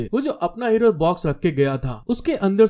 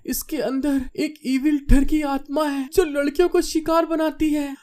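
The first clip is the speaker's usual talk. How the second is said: put-on anxious girl; 8 semitones higher than usual. A narrator's reading. Frightened girl eyes the box.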